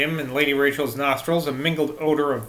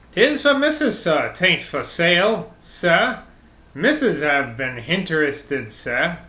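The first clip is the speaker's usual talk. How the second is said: lightly voiced rustic London accent; 2 semitones higher, slower than usual. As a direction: lightly play the old fisherman's London accent, slow and earnest. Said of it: "reverting to his North London accent".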